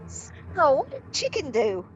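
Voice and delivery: high bird voice, slowing to the punchline; parrot voice. Acted. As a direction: draw out the question as a parrot. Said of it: "And the parrot says".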